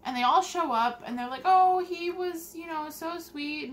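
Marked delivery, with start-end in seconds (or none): 1.4-3.7 high, slow, syrupy gushing; mock gushing mourner. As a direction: overdo the fake sympathy. Mocking gushing funeral neighbors.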